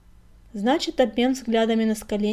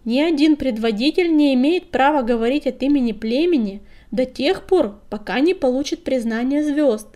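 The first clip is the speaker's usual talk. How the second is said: put-on higher, much louder cantankerous old cat; grumpy, raised voice; 4 semitones higher, 8 dB louder than usual. A narrator's reading. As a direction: grouse loudly so the whole meeting hears. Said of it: "сварливо проворчала старая кошка, повысив голос". (сварливо, повысив голос)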